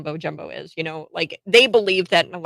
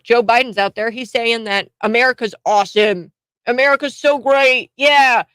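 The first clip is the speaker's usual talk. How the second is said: mocking; 5 semitones higher, 7 dB louder than usual. Mocking Biden's patriotic boasts.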